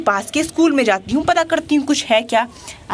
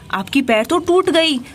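normal talking voice

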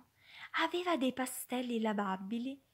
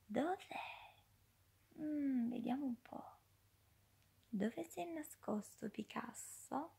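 whispered searching, soft and secretive; hushed guessing game; 5 semitones higher, 7 dB quieter than usual. Acted. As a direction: draw the children into the secret. (gioco sussurrato, con sorpresa)

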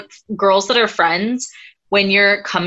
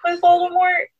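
smug Harry; 9 semitones higher than usual. Retold or imitated. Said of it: "Harry turns around and is like".

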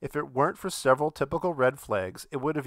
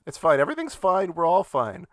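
mock reassurance; 5 semitones higher, 4 dB louder than usual. Ironic this-is-fine reassurance.

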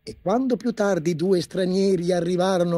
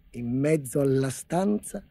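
ordinary delivery, nothing marked

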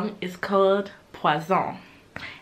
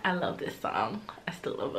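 soft unvoiced confession; whispered aside; 5 semitones lower, 7 dB quieter than usual. Real, not acted. Fan whispers her love for the song to viewers. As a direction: breathe it under the music.

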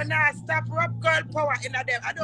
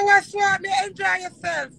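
loud, high acted outburst; retold angry confrontation; 7 semitones higher, 9 dB louder than usual. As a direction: act out catching them, building to a loud shout. Acting out confronting the sleeping pair.

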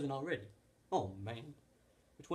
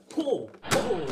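high, loud urgent pull; loud straining shout; 6 semitones higher, 11 dB louder than usual. Acted. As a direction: heave and yell it.

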